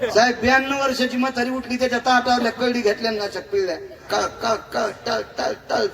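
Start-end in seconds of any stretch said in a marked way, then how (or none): 4.1-5.9 choppy, separated drip sounds; staccato sound-effect syllables; imitating dripping oil. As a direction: mimic each drop as its own short beat. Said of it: "तेल पडलं".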